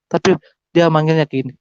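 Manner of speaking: ordinary talk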